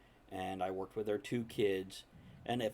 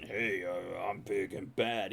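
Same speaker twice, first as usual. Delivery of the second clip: lower, slow tough-guy voice; 4 semitones lower, slower than usual. Retold or imitated. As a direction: play the big bad muscle man, slow and low. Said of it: "But instead of being".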